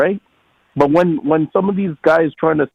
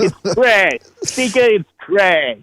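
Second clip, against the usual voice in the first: pitched-up impression of the actor; James Gandolfini; 4 semitones higher, slower than usual. Shown as a demonstration. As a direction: imitate the actor, voice lifted. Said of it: "say with the Gandolfini accent".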